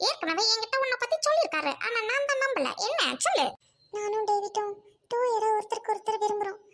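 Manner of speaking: ordinary talk, nothing marked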